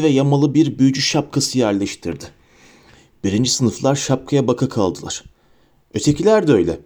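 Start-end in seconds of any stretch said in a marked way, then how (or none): none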